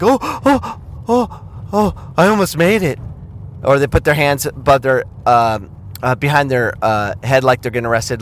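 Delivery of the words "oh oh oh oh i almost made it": mock dismayed player. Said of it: "Or like".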